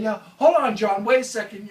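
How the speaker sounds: calling out to wait. Retold or imitated. To John on the slippery rocks, calling out to wait. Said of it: "I keep on saying".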